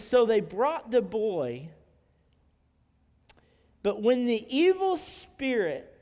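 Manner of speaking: slow, weighty Bible reading; dramatic scripture reading. Acted. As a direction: stretch out each phrase to build the horror of the scene. Reads scripture aloud dramatically.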